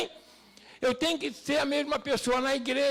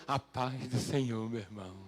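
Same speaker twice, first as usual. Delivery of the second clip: pious altar voice; 12 semitones lower, 6 dB quieter than usual. Retold or imitated. Preacher imitating churchgoers greeting piously at church, pious altar voice. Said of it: "Até a voz muda a entonação".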